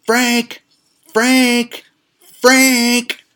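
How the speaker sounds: very high, loud screech of a name; imitated grandmother yelling. Grandmother calling across the parking lot, imitated high yelling. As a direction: mimic an old woman calling out, each cry drawn out. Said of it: "my grandmother is yelling".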